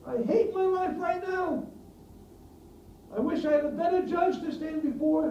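loud, high, anguished shouting at God; desperate furious outcry, voice straining high; Job crying out. Acted. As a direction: shout it out in despair, pitch up. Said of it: "What does Job say?".

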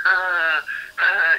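high, loud mock laugh; imitated laughter. Boxer mimicking sparring partners humouring a bad joke, high mock laughing. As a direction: imitate an obliging fake laugh. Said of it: "you know, like, laughing".

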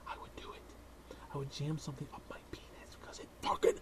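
soft hushed whisper; mock-earnest whisper; whispered aside. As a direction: whisper it like a dark confession. Whispered confession.

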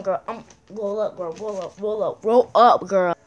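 ordinary talk

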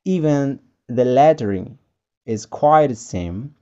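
ordinary talk, nothing marked

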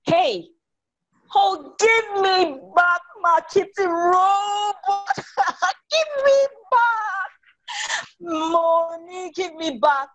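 high, slow tearful cry; whining little sister. Acted. Sister begging brother for her robot, high wailing. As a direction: wail for the toy back.

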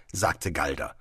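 ordinary delivery

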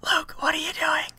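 high whispered scold; a sharp whispered interruption; whispering an annoyed question; hissed whisper. Acted. Someone hisses at him.

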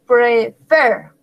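"Prefer" with a firm stress on "fer"; emphasis on last syllable. Shown as a demonstration. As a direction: punch the final "fer" of "Prefer". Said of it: "pues lleva el énfasis". ("Prefer" con énfasis en la última sílaba, "fer")

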